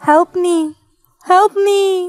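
rising desperate plea for help; ghostly plea. Acted. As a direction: beg like a lost spirit. Ghost lady begging for help.

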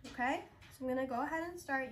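ordinary delivery

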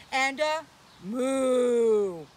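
long loud slow moo; cow moo. Retold or imitated. Kids' host mooing loudly for children.

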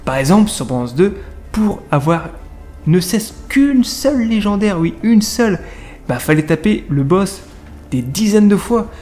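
ordinary delivery

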